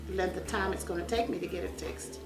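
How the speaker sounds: normal talking voice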